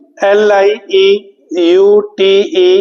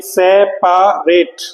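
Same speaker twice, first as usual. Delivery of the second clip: Separate slowly in three parts, se, pa, rate; syllable by syllable; much slower than usual. Shown as a demonstration. Teacher recapping the spelling for students, syllable by syllable. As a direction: separate the sounds of separate, sounding the middle pa. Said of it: "words को आप तोड़ेंगे syllable".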